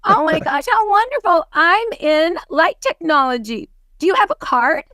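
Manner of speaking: high, delighted oh my gosh; gushing role-play. Shown as a demonstration. Role-playing with Garrett at Starbucks, gushing and delighted. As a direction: gush over the new contact. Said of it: "I'm at Starbucks".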